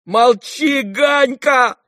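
very high, loud order to hush; angry shout. Acted. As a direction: shout to silence a brother. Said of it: "закричал Коля".

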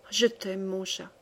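very soft, low love murmur; tender murmur. A narrator's reading. Wife embracing husband mouth to mouth, murmuring tenderly. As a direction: murmur it close, fading to almost nothing. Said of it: "lui murmurant entre ses lèvres".

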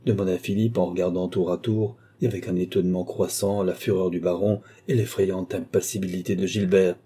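ordinary delivery

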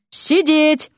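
high, drawn command; commanding exclamation; sharp command. Acted. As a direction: order it like to a dog. Dean commands the demon.

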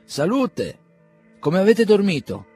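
loud, high, cheery greeting; hearty exclamation. Acted. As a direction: call out warmly across the garden. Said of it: "esclamò".

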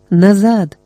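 restrained narrated cry of warning; urgent shout. A narrator's reading. Someone shouts from inside the study, mild narrated shout. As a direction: call out a short warning, without full force. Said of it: "хтось крикнув".

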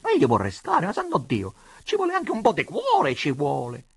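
plaintive, agitated pleading; wailing appeal; moaning. A narrator's reading. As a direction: lament it, leaping high in protest. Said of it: "gemette donna Adelaide".

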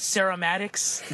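"ceramics" botched as "serra-MATICS"; mispronounced ceramics. Retold or imitated. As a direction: say "ceramics" wrongly as "SAIR-uh-MAT-iks". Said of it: "but he said ceramics".

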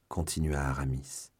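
ordinary delivery

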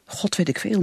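ordinary talk, nothing marked

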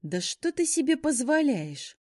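outraged demand. Acted. Werewolf outraged.

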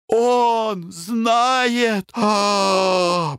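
high crying wail; high, tearful howl of despair. A narrator's reading. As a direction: cry it out in horror. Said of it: "завопил Клевринг".

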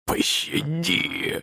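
very slow, voiceless wheezed plea; forced-out wheeze. A narrator's reading. Beaten guard begging for mercy, hoarse and forced out. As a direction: squeeze the word out through a swollen throat. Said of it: "выдавил он".

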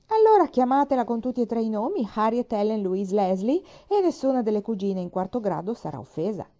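a proud grandmother rattling off names with disdain; haughty interruption. A narrator's reading. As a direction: cut in with haughty irritation. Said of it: "interruppe altezzosamente".

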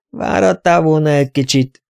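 understated wry reproach. A narrator's reading. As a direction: say it with a touch of sourness. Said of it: "mondta fanyarul Tereza".

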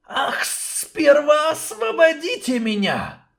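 high, slow hissing serpent; sly snake demanding his freedom. Acted. Snake king makes his demand.

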